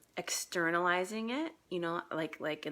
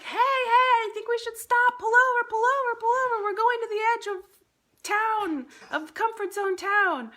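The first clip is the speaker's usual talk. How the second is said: much higher, louder squeaky panic voice; a frantic little gremlin begging to pull over; the inner gremlin shrieking to stop the car; panicky gremlin; 10 dB louder than usual. Retold or imitated. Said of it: "the gremlin voice ... it's like".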